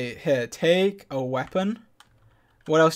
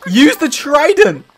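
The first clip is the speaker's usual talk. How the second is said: slow, high, loud command; desperately insistent, drawn-out shouting; 9 semitones higher, 9 dB louder, slower than usual. Real, not acted. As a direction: stretch it out as an urgent yell. Repeating urgent command in fight.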